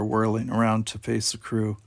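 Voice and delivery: ordinary delivery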